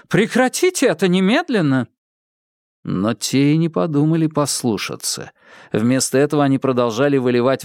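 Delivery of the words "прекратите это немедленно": commanding. Acted. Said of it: "попыталась командовать".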